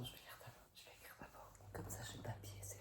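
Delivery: real soft, voiceless whisper; hushed, voiceless whispering; forced whisper. Real, not acted. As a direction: whisper to spare a lost voice. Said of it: "Je suis obligée de chuchoter".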